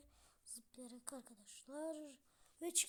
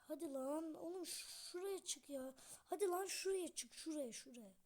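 usual speaking voice

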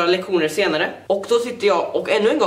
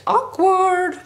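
much higher, sharp squeak; awkward squeak; 11 semitones higher than usual. Acted. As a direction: squeal it out in embarrassment. Comment after embarrassment. (gällt pinsamt pip)

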